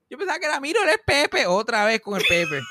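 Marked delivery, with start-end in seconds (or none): 0.1-1.5 put-on high, pleased old woman; lightly voiced grandma, cheerfully sure; grandma greeting her son, pitched up and pleased; happy grandma voice. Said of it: "se pone bien contenta".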